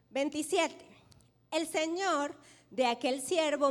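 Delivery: normal talking voice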